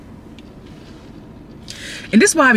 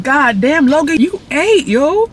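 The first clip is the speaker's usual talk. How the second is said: excited praise; 8 semitones higher, slower than usual. Retold or imitated. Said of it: "I said".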